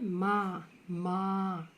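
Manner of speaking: माँ as maa, open oral vowel; denasalised माँ; wrong, without nasal. Shown as a demonstration. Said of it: "माँ तो नहीं होता है".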